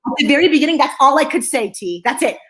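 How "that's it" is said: usual speaking voice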